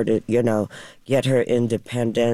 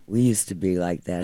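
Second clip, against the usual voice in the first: castmate in her ear; 6 semitones lower than usual. Retold or imitated. Castmate reminiscing in her ear on set, lightly voiced low aside. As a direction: drop it low, confiding in an ear. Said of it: "came in my ear and he said".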